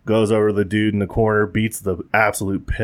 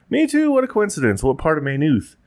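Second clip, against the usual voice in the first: amazed; 8 semitones higher than usual. Acted. Irishman amazed at the coincidence.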